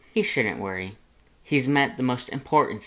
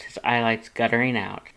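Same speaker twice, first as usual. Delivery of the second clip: plain reading voice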